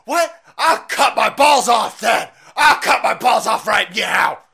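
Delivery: high, shrieking mock outrage, slow; mock lefty. Lefties reacting to Trump, in a shrill mocking shout. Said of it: "And lefties go".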